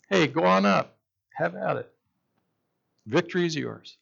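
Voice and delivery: lightly put-on false assurance; mildly phony encouragement. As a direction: cheer them on without quite meaning it. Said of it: "And that sounded phony".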